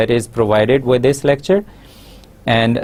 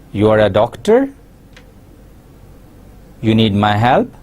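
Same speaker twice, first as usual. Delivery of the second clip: statements asked as questions, rising on "doctor" and "help". Shown as a demonstration. Teacher turning statements into questions, rising on "doctor" and "help". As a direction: push "doctor" and "help" sharply upward. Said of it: "by asking it in rising tone".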